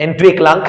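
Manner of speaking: teacher models এন্ট্রিক লাং, en-trik lang, for repeating; model pronunciation. Shown as a demonstration. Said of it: "আমার সঙ্গে উচ্চারণ করে বলো".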